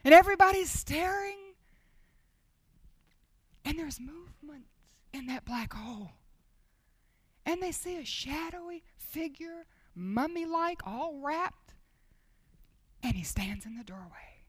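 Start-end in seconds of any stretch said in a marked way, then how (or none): 3.6-14.4 softer, whispered storytelling; hushed suspense. Acted. Preacher describing Lazarus appearing, soft hushed whisper. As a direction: draw them in with a hush.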